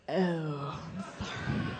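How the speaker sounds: hushed, pitying condolence; mock disappointed. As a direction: drop low and fade to a near whisper. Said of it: "I got the disappointed".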